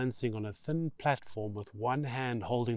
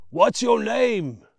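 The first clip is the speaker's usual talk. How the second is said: loud, high, slow bellow; shouted question from a machine; roaring; 11 semitones higher, 10 dB louder, slower than usual. Acted. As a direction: roar it down over the engine. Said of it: "the man roars the question".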